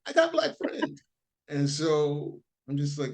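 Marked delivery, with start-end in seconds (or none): 0.1-1.0 much higher mock voice; high mocking falsetto repeating a favorite line. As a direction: squeal it in parody. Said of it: "I love that line".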